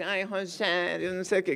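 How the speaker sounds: lightly voiced woman character; woman's voice. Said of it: "que eu faço essa voz normal".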